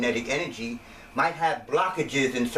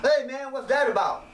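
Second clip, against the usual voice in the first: much higher, startled mock question; startled people; 10 semitones higher than usual. Skeptics hearing about sexual kung fu, startled higher question. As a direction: ask it like a shocked skeptic. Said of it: "when we get to the sexual kung fu".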